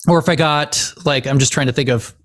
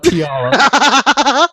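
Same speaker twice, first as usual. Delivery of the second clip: higher mock yell with laughter; retold yell; 7 semitones higher, 4 dB louder than usual. Recalling a yell at a football player, mock yell. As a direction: re-enact calling out, laughing. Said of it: "I yelled out".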